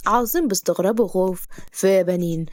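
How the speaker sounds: ordinary delivery, nothing marked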